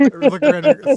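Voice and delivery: loud, high mock British accent; teasing British impression, high and loud; mimicked British accent. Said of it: "Stewie from Family Guy".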